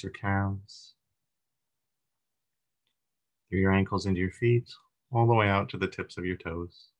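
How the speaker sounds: calm meditation leader, long pauses between phrases; guided meditation. Acted. Leader guiding a group through breathing and body relaxation, meditative. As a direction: guide them gently into relaxation, unhurried, leaving room to breathe.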